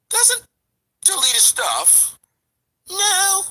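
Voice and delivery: mocking high. Mocking mimic of rival's claim.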